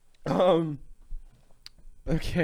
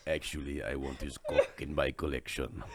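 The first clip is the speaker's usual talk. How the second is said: low, soft villain voice; Craven; 8 semitones lower, 5 dB quieter than usual. Acted. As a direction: play a collector villain, low and hushed.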